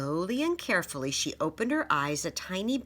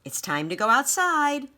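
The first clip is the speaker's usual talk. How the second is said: put-on excited child; 8 semitones higher, 5 dB louder than usual. Child announcing news.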